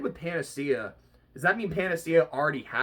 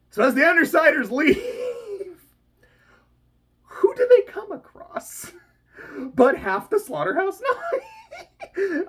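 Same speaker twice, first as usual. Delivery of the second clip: building, high-pitched excitement; excited; 12 semitones higher, 4 dB louder than usual. Real, not acted. Vlogger gleefully revealing the villains, excited. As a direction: squeal out the reveal.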